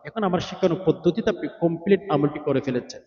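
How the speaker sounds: ordinary delivery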